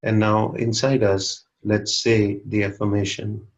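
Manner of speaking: measured meditation leader reciting an affirmation; guided affirmation. Real, not acted. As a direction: give each phrase room for listeners to repeat inwardly. Leads a silent affirmation.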